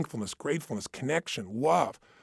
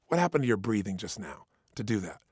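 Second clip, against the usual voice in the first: normal talking voice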